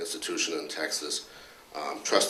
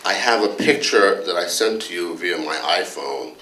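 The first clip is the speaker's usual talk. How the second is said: louder, a touch indignant complaining colleague; Dr. Anderson; 7 dB louder than usual. Retold or imitated. Colleague grilling the department head about hospital rules, mock indignant. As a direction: press the boss with mock exasperation, half-laughing. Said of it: "went something like this".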